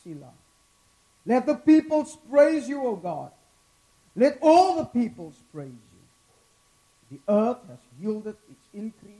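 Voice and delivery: loud, rising scripture reading; dramatic scripture reading. Acted. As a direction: proclaim the psalm to the congregation. Reads the psalm aloud with force.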